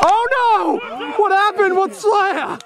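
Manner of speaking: high-pitched startled exclamation; excited alarm. Real, not acted. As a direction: cry out at a sudden tumble. Reacting to a player falling.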